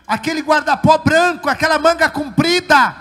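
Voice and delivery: loud, high-pitched revival testimony; full-force testimony preaching. Real, not acted. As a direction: preach it out to the whole church.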